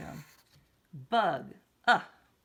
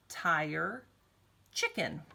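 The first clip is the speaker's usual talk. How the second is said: ordinary talk, nothing marked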